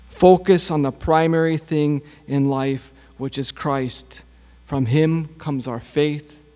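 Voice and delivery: normal talking voice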